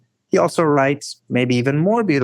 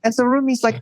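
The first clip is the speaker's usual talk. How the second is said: usual speaking voice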